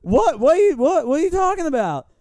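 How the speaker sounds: high, loud baffled what; feigned innocence. Retold or imitated. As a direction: play dumb in loud disbelief. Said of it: "I was like".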